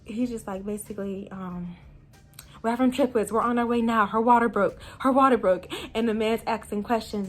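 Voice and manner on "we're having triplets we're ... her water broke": put-on frantic boyfriend. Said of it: "He says".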